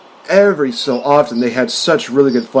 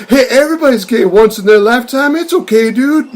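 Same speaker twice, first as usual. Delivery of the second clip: high, loud, hammed-up line; mock loud reassurance; 11 semitones higher, 5 dB louder than usual. Downey's character ends the scene, loudly reassuring his buddy. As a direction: blurt comfort brightly at a high pitch.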